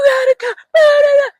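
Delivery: high-pitched, loud fake sobbing; mock crying. Woman phoning him after seeing the bodies, mock crying. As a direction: wail it as an exaggerated tearful plea. Retold panicked phone call for help.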